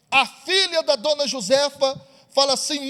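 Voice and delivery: normal talking voice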